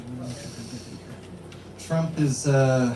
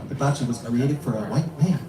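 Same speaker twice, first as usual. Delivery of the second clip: lower-pitched, lightly put-on Japanese accent; dad's Japanese accent; 5 semitones lower than usual. Comedian quoting dad to the crowd, mock Japanese accent. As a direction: imitate a Japanese father's accent. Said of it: "my dad's racist Japanese accent".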